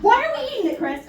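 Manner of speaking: exasperated. Acted. Scolding mom about old food.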